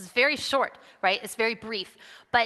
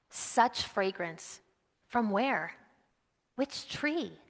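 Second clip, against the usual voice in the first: poem reading; 5 dB quieter, much slower than usual. Shown as a demonstration. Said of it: "And it goes like this".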